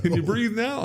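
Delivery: Cagney mocking the man in the trunk, high and sly; taunting gangster. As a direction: toy with the guy cruelly, voice way up. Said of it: "gangster after shooting trunk".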